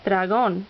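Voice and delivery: dragón with GÓN stressed; stress on accented syllable. Shown as a demonstration. Teacher listing words with written accents, stress on accented syllable. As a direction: land on the final syllable of dragón. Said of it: "words that need written accents".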